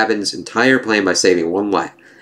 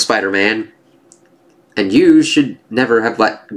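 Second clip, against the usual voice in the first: normal talking voice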